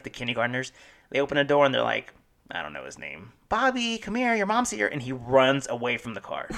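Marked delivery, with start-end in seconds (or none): 3.5-4.9 high, carrying call across the pickup line. Retold or imitated. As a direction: call the kid over from a distance. Teacher calling kid to car.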